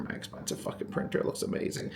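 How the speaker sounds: quick whispered aside. Real, not acted. Bragging about his expensive printer, whispered aside. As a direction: drop to a fast whisper for the joke.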